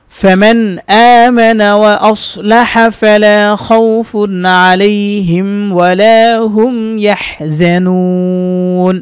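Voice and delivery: slow, melodic tajweed chant with long vowels; Quran recitation. Acted. Teacher recites the whole verse for students in a slow chant. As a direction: draw each vowel out in a measured recitation.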